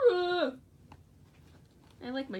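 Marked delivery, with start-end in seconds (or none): 0.1-0.5 short high, loud "ah"; demonstrated scream. Shown as a demonstration. Showing viewers their own screams, short high scream. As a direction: give a quick mock scream. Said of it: "like what I just did".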